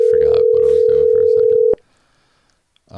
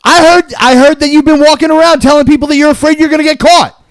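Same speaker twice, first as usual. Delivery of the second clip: louder, higher angry gloating; angry shouting; 6 semitones higher, 5 dB louder than usual. Acted. Caller taunting Mason that he got caught, shouting. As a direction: crow it at him, loud.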